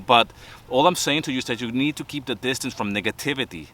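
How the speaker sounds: ordinary delivery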